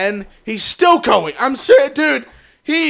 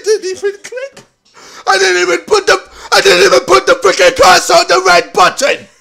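higher, louder words through laughter; laughing disbelief; 9 semitones higher, 8 dB louder than usual. Real, not acted. Gamer laughing at missing the red button, higher and loud. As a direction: laugh at your own blunder.

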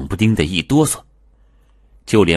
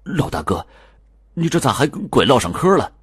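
embarrassed; 8 semitones higher than usual. Acted. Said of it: "难为情的小声说".

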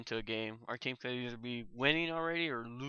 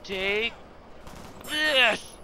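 battle cry; 11 semitones higher, 6 dB louder, much slower than usual. Real, not acted. Firing at enemy.

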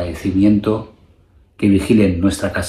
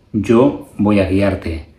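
ordinary delivery

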